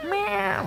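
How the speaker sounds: a high, squeaky mock meow; playful meow. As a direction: meow up at the cat like a kitten. Talking to her cat.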